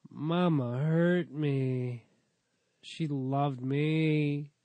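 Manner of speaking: very slow, drawn-out words; mock lament. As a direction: stretch each word in mock heartache. Mock lament over old girlfriend.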